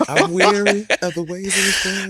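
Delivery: high, loud, laughing mock-weary line. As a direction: giggle out a weary sigh.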